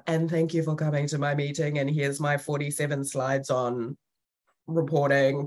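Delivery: low, monotone presentation drone; boring spiel. Retold or imitated. Presenter opening a routine meeting with slides, bored monotone. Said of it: "my normal boring spiel".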